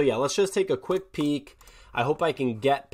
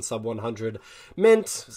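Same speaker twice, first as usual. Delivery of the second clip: usual speaking voice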